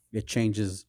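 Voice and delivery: ordinary talk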